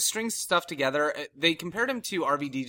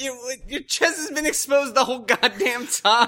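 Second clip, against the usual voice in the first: higher, louder and slow disbelieving scold; mock exasperation; 11 semitones higher, 5 dB louder than usual. Fan recalling his reaction to the wrestler: high slow exasperated scold. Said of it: "I was like".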